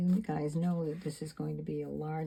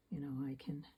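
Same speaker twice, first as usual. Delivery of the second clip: whisper; 2 semitones lower, 8 dB quieter, faster than usual. Real, not acted. Quiet aside while painting.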